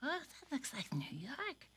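whispered puppet remark. Acted. Puppet cat awed by the city picture, whispering. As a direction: breathe it in awe.

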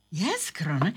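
ordinary delivery, nothing marked

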